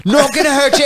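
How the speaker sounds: high, loud-ish mock Australian accent; Steve Irwin. Crocodile hunter character to the man held down, high Australian impression. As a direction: reassure the catch like an Aussie wildlife host. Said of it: "narrating it in an Australian accent".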